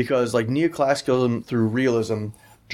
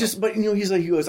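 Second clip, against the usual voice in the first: usual speaking voice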